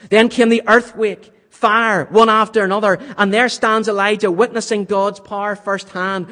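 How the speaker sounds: forceful sermon storytelling; preaching at full force. Acted. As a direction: build the storm with rising power. Dramatic retelling of the storm.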